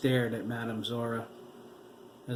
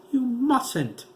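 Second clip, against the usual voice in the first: high, loud, drawn-out cry; anguished cry; 13 semitones higher, 9 dB louder than usual. A narrator's reading. Daughter crying out to her mother, loud anguish. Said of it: "Maria cried".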